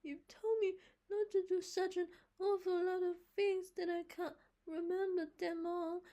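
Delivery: put-on high, loud whiny boy; a young boy excusing himself to Marilla; little boy voice. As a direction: plead like a scolded child. Davy's child voice.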